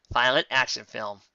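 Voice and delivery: usual speaking voice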